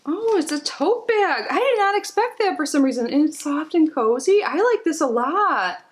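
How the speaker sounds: high delighted gush; excited. Real, not acted. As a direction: squeal over the surprise gift. Delighted at surprise tote bag.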